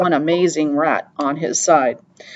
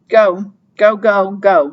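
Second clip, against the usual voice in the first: louder, higher go go, herding him on; mock shooing; 4 semitones higher, 6 dB louder, slower than usual. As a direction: shove him along with each go. Rip Red shoves Henry forward.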